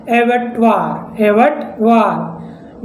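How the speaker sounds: एवटवार drawn out very slowly, said twice; slow model word. Shown as a demonstration. Teacher to students, modelling the word very slowly. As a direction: sound out एवटवार slowly, syllable by syllable. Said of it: "जैसे".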